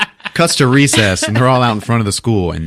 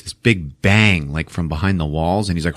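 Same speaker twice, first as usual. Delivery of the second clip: ordinary talk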